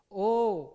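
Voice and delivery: loud, drawn-out O with rounded lips; rounded vowel demo. Shown as a demonstration. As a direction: round the lips and sound O clearly. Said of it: "जैसे".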